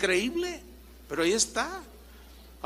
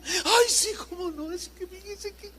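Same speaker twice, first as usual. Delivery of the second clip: whiny patient; 11 semitones higher than usual. Retold or imitated. Patient answers doctor.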